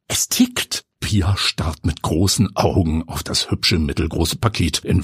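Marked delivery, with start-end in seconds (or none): 0.1-0.9 breathless, stunned whisper; shocked whisper. Acted. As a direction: freeze in horror. Pia erschrickt. (erschrocken geflüstert)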